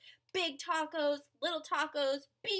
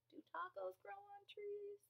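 small curious voice; 6 semitones higher, 15 dB quieter than usual. Acted.

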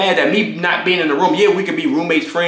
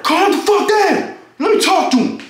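much higher, slow stressed father voice; stressed-out dad; 12 semitones higher than usual. Retold or imitated. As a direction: play the panicking dad taking charge. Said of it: "gets stressed out in situations".

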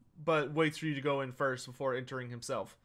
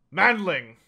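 loud gruff call of a dwarf lord; a proud dwarf lord booming one word at the newcomer; dwarf's bark; 3 semitones higher, 8 dB louder than usual. Acted. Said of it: "turns his attention back, to Vertstedt and says".

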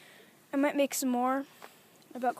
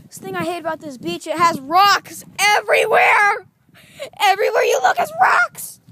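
angry shout; 6 semitones higher, 12 dB louder than usual. Real, not acted. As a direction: yell out in pain and complaint. Shouting complaint about rocks.

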